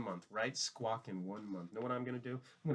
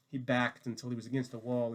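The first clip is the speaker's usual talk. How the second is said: ordinary delivery, nothing marked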